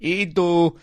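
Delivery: slow, lightly voiced Russian scientist; a hint of a Russian game character; Russian accent. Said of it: "and then he'd be like".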